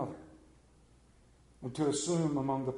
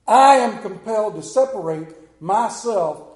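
sudden loud higher preaching push; 6 semitones higher, 14 dB louder than usual. Real, not acted. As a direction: lift it into the pulpit, loud. Preacher rises to full force.

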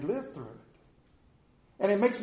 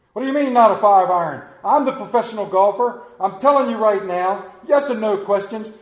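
louder, fast indignant scolding; indignant golfer; 2 semitones higher, 7 dB louder than usual. Acted. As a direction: bark back like an offended pro.

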